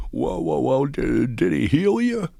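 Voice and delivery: put-on high, loud stammering old priest; old Jesuit priest. Old priest startled by the healing story, high loud stammer. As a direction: sputter like a flustered old Jesuit. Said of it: "cross between Jimmy Stewart and Elmer Fudd".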